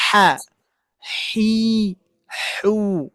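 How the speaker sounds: ha, hi, hu, the h coloured by each vowel; ha hi hu, plain vowel sounds. Shown as a demonstration. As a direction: let the vowel shape the h. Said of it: "menyesuaikan dengan bunyi harokatnya".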